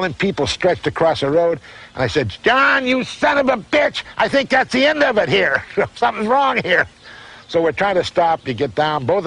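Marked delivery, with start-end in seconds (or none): 2.4-6.9 high, excited yell to a racing buddy; alarmed yell. Retold or imitated. Racer yelling to his buddy about the ice ahead, high excited shout. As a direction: shout the warning over the engines, pitched way up.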